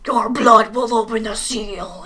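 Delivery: lightly voiced slow villain line; a hint of drawn-out menace; Dark Link. Villain warning Link about the seal, lightly ominous. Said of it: "Dark Link's like".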